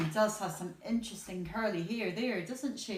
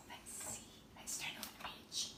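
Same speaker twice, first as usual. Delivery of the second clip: very soft whisper; 12 dB quieter than usual. Acted. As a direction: hush it to sleepy children. Whispered page turn prompt.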